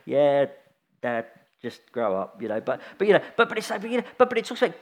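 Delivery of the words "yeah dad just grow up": put-on low, slow teenage disdain; fed-up kid dismissing Dad; weary put-down. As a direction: drop it low and unimpressed. Child's weary reply, acted.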